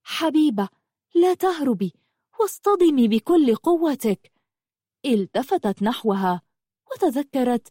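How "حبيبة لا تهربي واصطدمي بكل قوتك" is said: high, emphatic urging; rally someone to stand firm; steadfast. A narrator's reading. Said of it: "وقالت لحبيبة بثبات". (بثبات وحزم)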